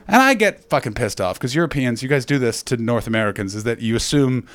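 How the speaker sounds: ordinary talk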